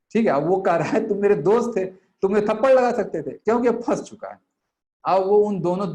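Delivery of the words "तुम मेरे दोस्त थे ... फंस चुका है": higher, louder, rushed protest to a friend; agitated outcry. Retold or imitated. Caught DM to his friend, agitated high rushed outcry. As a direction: push it out fast and high, stung by betrayal.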